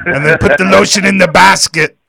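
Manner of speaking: mimicking the boss giving a creepy order; mock creepy order. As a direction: ham up a creepy captor's command. Mock boss order, movie parody.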